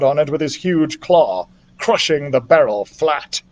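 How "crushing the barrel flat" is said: ordinary delivery, nothing marked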